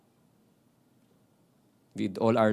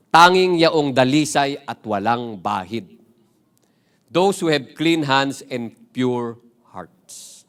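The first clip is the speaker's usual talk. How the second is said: slow, weighty, louder reading of the quote; slow solemn reading; 8 dB louder, slower than usual. Acted. Pastor to the youth, reading Rizal's answer slowly and gravely. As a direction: lay each word down slowly like a verdict.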